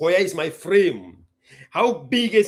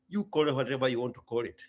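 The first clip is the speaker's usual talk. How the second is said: ordinary delivery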